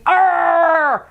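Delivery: long exasperated "arrgh"; drawn-out mock growl of annoyance; frustrated growl. Imitated frustration at interrupted movie.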